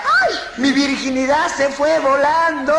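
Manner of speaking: very high, slow comic shriek; hammed-up high-pitched cry; mock scream. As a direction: wail it out, stretching the last word. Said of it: "Y ella grita".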